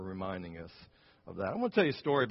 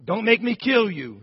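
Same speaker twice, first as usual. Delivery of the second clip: high, loud shouted threat; 6 semitones higher, 7 dB louder than usual. Retold or imitated. Escaped inmate threatening the elderly couple, loud shout. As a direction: bark the threat at them. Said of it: "he, uh, shouted".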